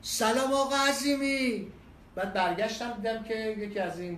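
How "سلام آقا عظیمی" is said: shouted greeting from a distance; loud shout across the station. Retold or imitated. Attendant shouting a greeting across the pump lanes, loud call. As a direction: call out his name loudly. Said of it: "با صدای بلند داد زد".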